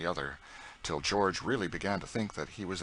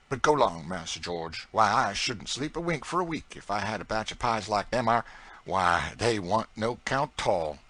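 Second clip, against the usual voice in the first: put-on higher, louder proud old cook in broad dialect; Aunt Chloe's voice; 6 semitones higher, 7 dB louder than usual. A narrator's reading. Aunt Chloe boasting to young George, broad proud dialect. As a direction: boast with a scornful toss of the head.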